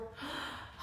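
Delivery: sharp breathy gasp of relief; a quick startled intake. Acted. Bear falls out.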